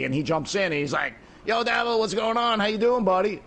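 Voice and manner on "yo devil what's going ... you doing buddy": higher, upbeat buddy voice calling out hello; cheery friend greeting. Retold or imitated. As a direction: greet your pal like you just walked in.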